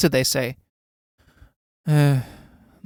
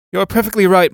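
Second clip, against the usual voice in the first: mock agreement; 5 semitones higher, 6 dB louder than usual. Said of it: "my yawn can be taken to mean".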